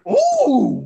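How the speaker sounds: high ooh. Retold or imitated. Brian reacts to the crack.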